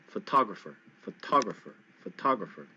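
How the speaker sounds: photographer with TO hit loud, pho-TO-grapher; stress on TO. Shown as a demonstration. Teacher correcting students, pho-TO-grapher stressed. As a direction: punch TO in photographer. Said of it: "you hit the T O really loud".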